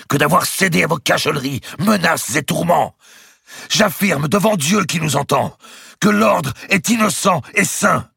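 high, loud voice building to a peak; impassioned proclamation. A narrator's reading. Grand master swears the order's innocence before God, impassioned proclamation. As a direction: throw the voice out, slowing on key words. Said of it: "la voix du grand maître, lancée".

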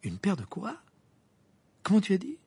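high, affronted question; offended, rising disbelief; indignant. A narrator's reading. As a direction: challenge it in indignation. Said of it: "laissa échapper une exclamation indignée".

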